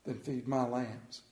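calm Jesus. Acted. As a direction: quietly give the command. Jesus answering Peter gently.

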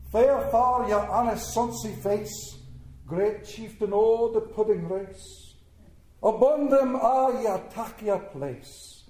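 slow, rolling mock Scottish brogue recital; hammed-up Scottish accent poem reading. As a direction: declaim it like a proud Scot, slowly. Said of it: "I'm gonna do it in a Scottish brogue".

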